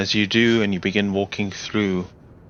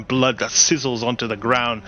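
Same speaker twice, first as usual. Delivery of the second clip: ordinary talk, nothing marked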